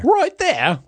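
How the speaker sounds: high hammed-up British accent; mock British accent. Cohost joins in with a mock British accent. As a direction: echo it like a Brit. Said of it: "flawless British accent right there".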